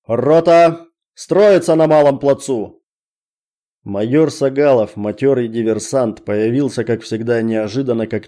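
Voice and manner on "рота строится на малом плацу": shouting command. Acted. Officer commands the company.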